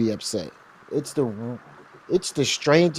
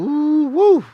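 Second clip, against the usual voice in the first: excited whoop; 8 dB louder than usual. Real, not acted. Reacting to angry emails.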